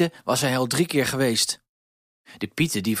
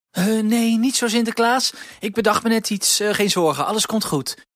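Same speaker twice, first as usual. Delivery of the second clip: high, louder, hurried reassurance; flustered Huispiet; 11 semitones higher, 4 dB louder than usual. A narrator's reading. Huispiet hides the problem from Sinterklaas, flustered. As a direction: pitch way up, covering a secret.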